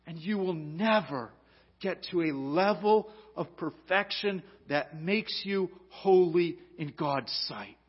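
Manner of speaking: high, loud preaching at full force; fervent preaching. Acted. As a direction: preach it out to the whole congregation.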